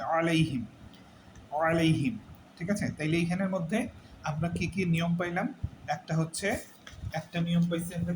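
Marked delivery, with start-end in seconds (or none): 0.0-2.2 "আলাইহিম" short and brisk, no vowel held; quick, unstretched. Shown as a demonstration. Teacher modelling the correct way, "আলাইহিম" said quickly without stretching. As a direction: say "আলাইহিম" promptly in one go. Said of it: "দ্রুত বলতে হবে".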